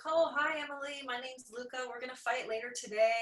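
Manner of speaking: imitating a chirpy opponent; lightly voiced cheerful rival; friendly greeting. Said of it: "she's like".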